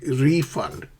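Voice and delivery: "Refund" said REE-fund, first syllable long and strong; stress on first syllable. Shown as a demonstration. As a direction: hit the RE in "Refund". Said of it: "pronunciation focus".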